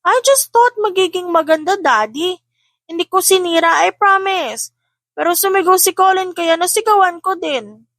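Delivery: put-on a cross older boy snapping at his siblings; annoyed child voice. A narrator's reading. Cassian scolding siblings: high, annoyed child voice.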